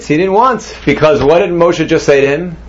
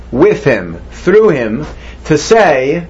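ordinary talk

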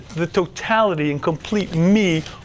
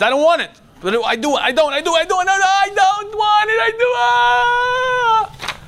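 going back and forth, then breaking into a yell; torn inner struggle; 13 semitones higher than usual. Acted. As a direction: voice climbing into a torn shout. Acting out inner contradiction.

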